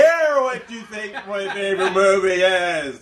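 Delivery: cutting him off in a put-on voice; mock indignant retort. Real, not acted. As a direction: fake outrage at being interrupted. Friend keeps interrupting his review.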